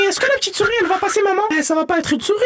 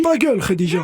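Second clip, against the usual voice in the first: gruff dad; 10 semitones lower than usual. Acted. Père qui rembarre sa femme.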